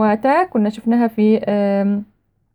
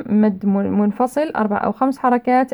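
ordinary delivery

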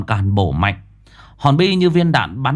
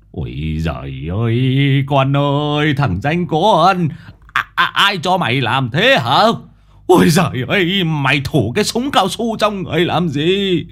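put-on old man scolding; 6 semitones higher than usual. Wounded grandfather scolding his grandson, wailing and scolding. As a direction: lament then scold the boy, half frightened half cross.

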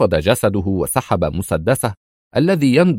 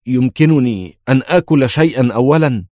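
usual speaking voice

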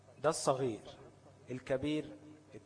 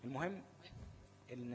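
usual speaking voice

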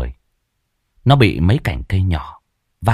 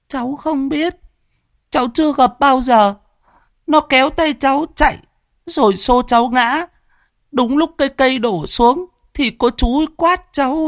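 put-on high child's voice, stumbling and scared; a shaken little boy stammers answers to grown-ups; stammering little boy. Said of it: "Thằng cu tít lắp bắp". (giọng bé trai lắp bắp, cao)

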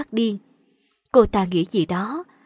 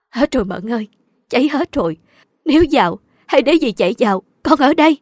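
raised voice, growing louder; desperate pleading; 3 semitones higher, 6 dB louder, faster than usual. A narrator's reading. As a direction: beg and hold her back. Aunt restrains her, pleading. (van nài khẩn thiết, lớn dần)

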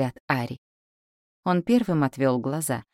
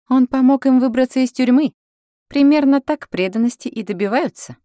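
higher, louder, pointed character voice; woman character speaking up; 10 semitones higher, 8 dB louder than usual. A narrator's reading. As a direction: raise the voice high and make the point. A character's line, raised voice. (высокий, громкий голос героини)

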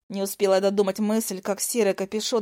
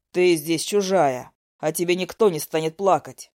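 low elder voice; 5 semitones lower, 3 dB louder than usual. A narrator's reading.

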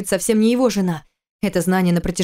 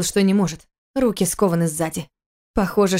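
ordinary delivery

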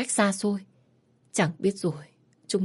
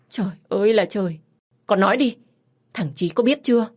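put-on a frantic mother berating her child; scolding mother; 3 semitones higher, 4 dB louder than usual. Mother scolding her teenage daughter, loud and distraught.

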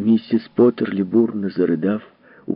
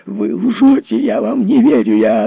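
high, loud angry outburst; furious accusation; 13 semitones higher, 8 dB louder than usual. Acted. Potterley shouts at Foster, furious. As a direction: fling the accusation at him.

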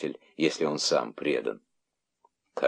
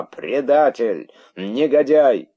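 high, loud, drawn-out insults; indignant shouting; 7 semitones higher, 6 dB louder, slower than usual. A narrator's reading. Crowd denouncing the herald, indignant shouting. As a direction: hurl each insult like a mob. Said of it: "кричала возмущённая толпа".

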